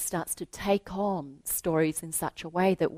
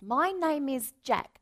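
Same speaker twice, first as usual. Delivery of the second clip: put-on higher, earnest little boy voice; young boy Jack; 5 semitones higher than usual. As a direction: speak up shyly like a small boy. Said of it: "He says".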